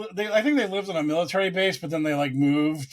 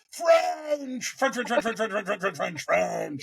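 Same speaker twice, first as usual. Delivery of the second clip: mock coach answering in French; 4 semitones higher than usual. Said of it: "the Bruins coach just looks at the guy and goes".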